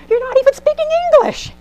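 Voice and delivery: high, loud exasperated outburst; grandma at Starbucks, fed up and high-pitched. Retold or imitated. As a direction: throw up your hands as a fed-up grandma. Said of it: "Grandma goes".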